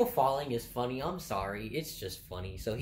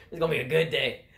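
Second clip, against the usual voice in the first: loud, higher gleeful retelling; amused, punched-up delight; 4 semitones higher, 9 dB louder, faster than usual. Retold or imitated. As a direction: crow it between laughs. Repeats it louder between laughs.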